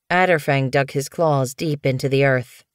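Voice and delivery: ordinary delivery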